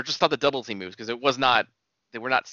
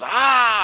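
imitated shout; 10 semitones higher, 7 dB louder than usual.

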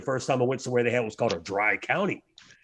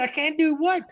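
panicked. Retold or imitated. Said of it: "I'm panicked".

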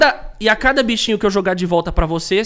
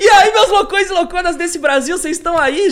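excited greeting; 10 semitones higher, 4 dB louder than usual. Real, not acted. Host greeting viewers.

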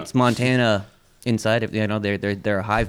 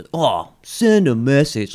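slow mock drawl; 3 semitones higher, 3 dB louder, much slower than usual. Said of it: "he's like".

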